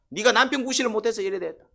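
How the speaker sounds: acted blaming outburst, high and loud; loud, high-pitched mock accusation; resentful complaint. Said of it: "고통 중에 불평이 나와요".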